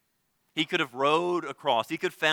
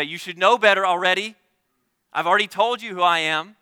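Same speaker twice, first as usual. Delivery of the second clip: louder and higher, a rebuking teacher voice; mock scolding; 6 semitones higher, 8 dB louder than usual. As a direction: scold them as if they should know better. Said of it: "He doesn't say".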